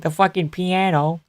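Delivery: child imitating a Boston wiseguy; mobster Boston accent. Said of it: "I'm talking like a mobster".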